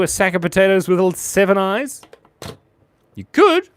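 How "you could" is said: squeaky voice. Pretend. Squeaky answer.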